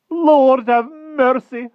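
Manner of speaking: put-on high, slow, loud old woman's cry; old nurse crying out. A narrator's reading. The nurse hearing the news, slow loud high cry. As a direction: wail it like a startled old nurse. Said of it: "cried the nurse".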